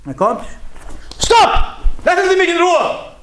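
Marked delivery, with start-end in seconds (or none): 1.2-3.1 high, loud shouted Stopp. Shown as a demonstration. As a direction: yell it so everyone hears. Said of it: "aber ich muss laut sein".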